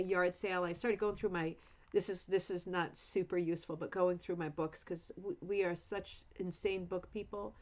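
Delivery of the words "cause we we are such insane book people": ordinary delivery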